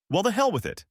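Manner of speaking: high, sharp exclamation; exclaimed. A narrator's reading. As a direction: throw the words up in exasperation. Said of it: "I exclaimed".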